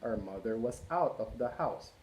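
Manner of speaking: ordinary delivery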